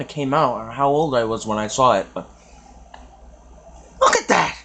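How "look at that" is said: shocked. Real, not acted. Reacting to gross clip.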